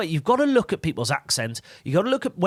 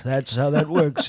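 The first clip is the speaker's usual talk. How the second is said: ordinary talk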